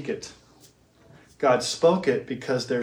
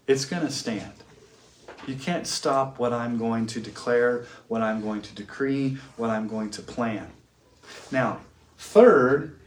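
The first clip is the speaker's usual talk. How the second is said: ordinary delivery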